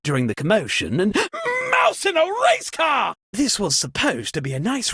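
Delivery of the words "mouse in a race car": high, shocked shout of a made-up oath; startled cry. Acted. Host startled by the blackout, high shocked shout. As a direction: yelp the oath in sudden alarm.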